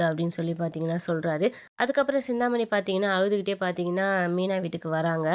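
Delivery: ordinary delivery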